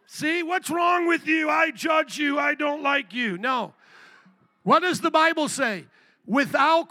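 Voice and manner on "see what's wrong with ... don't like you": raised, high accusing shout; mock judgmental yell. Pastor acts a churchgoer judging a balut eater, shouting. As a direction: play the petty judge, hammed up.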